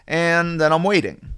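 slow, stretched-out "waiting" at normal volume; drawn-out waiting. Retold or imitated. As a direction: begin the wait, stretching "waiting". Acting out a long wait.

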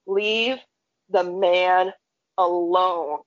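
measured, word-by-word command; low, firm retold order; stern warning. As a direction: lay down the law, pausing between phrases. Reining in friend by her shirt.

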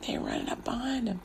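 very soft, near-whispered aside; hushed repeat. A narrator's reading. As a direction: drop right down to a hush. Hushed repeat after the climax.

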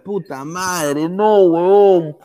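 slow drawn-out anguished yell; anguished shout. Real, not acted. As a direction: cry out in agony. Decisive moment, live reaction.

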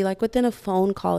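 ordinary talk, nothing marked

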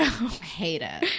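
slow, breathy whisper of distaste; whispered disgust. Real, not acted. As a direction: whisper it like a pained confession. Muttered aside about the song.

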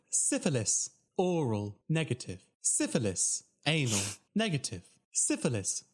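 robotic Siri-like readout, each word separate; automated phone voice. Retold or imitated. Results hotline listing STD results, stilted automated voice. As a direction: recite each item with the same falling tune. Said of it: "imagine Siri giving you your S T D results".

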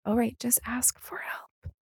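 breathy whisper to herself; hushed, telling herself off; whispered self-reminder. Retold or imitated. To herself at work, a soft whisper.

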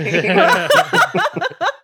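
high, loud cackling laugh; helpless laughter. Real, not acted. Host still tickled by the bad accent, high laughter.

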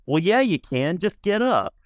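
put-on high, breezy little-kid voice; matter-of-fact little boy. Boy tells the wheelchair user to just get up, high and innocent. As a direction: pitch it way up, totally sure of himself. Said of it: "he goes".

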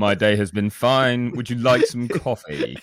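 slow, rich imitated voiceover, laughing through it; teasing a friend with a put-on sultry voice; mock voiceover voice. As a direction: ham up a sultry voiceover, slowly. Mocking the voiceover voice.

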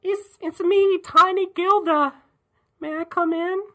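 very high, put-on little Gilda; tiny Gilda voice. Gilda knocking on Crone's door, squeaky role-play voice. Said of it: "Gilda knocking on Crone's".

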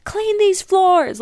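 slow, high, loud mock yell at the family; hammed-up early morning yell; imitated yell. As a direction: bellow it like a relative yelling at dawn. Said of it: "They're always yelling at each other".

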